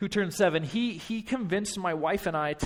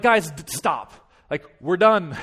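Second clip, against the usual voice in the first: firm, louder exasperated order; losing patience; 6 dB louder, slower than usual. Retold or imitated. Coach ending the boys' basketball game, losing patience. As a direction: cut the game off, fed up. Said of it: "starting to lose my patience a bit".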